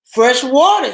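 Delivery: high, loud excited shout. Acted. Armadillo shouts with joy on reaching the fresh water, high and loud. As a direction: call it out with joy. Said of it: "he shouted".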